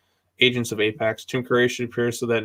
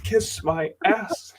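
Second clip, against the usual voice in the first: villain taunt; 9 semitones higher, much slower than usual. Pretend. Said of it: "Alan Jonah talking shit to the Titans".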